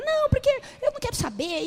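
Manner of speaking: high, petulant mock sister voice; sister's whiny retort. Preacher mimics her sister refusing to care, high petulant voice.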